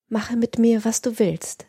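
ordinary delivery, nothing marked

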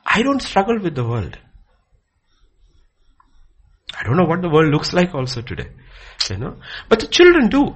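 high, loud sermon voice; heated preaching. Real, not acted. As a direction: press the point to the congregation. Preaching, raised voice.

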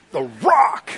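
high, strained mock-macho boast; hyped-up swagger. Peter shows off his new nickname, hammed-up swagger. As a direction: push the name out like a wrestler.

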